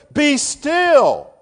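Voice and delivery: full-force booming command, very high and loud; fortissimo shout. Shown as a demonstration. Preacher showing God's fortissimo command, shouting. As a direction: thunder it out like God commanding. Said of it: "This is fortissimo".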